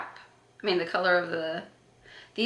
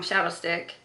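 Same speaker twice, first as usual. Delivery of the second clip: ordinary talk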